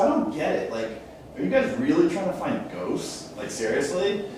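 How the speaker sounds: a hint of incredulous California girl talk. Host imitates a sceptical producer, light California girl voice. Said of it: "California girl".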